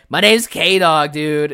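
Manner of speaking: slow, loud, high dumb dude; K-Dog. Retold or imitated. Player's dumb alter ego introducing himself to friends, loud slow dude. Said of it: "the dumb personality".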